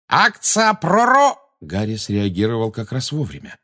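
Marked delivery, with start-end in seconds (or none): 0.1-1.4 very high, loud, drawn-out screech; shrieked spell. A narrator's reading. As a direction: shriek the incantation, cut off at the end. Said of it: "пожирательница смерти взвизгнула". (пронзительно взвизгнуть)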